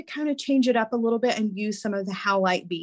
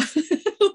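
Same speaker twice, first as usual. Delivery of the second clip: short light laugh; 11 semitones higher, 3 dB louder than usual. Real, not acted. Laughs at her own joke.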